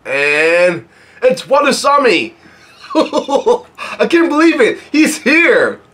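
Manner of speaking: high, drawn-out squeal of delight with laughing; real excitement. Real, not acted. Reader sees Watasami arrive to save them, real excitement. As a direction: cry out slowly, thrilled and giggling.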